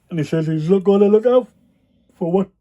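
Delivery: words stretched by a yawn. Real, not acted. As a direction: talk through a big yawn. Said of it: "I always yawn when I do these videos".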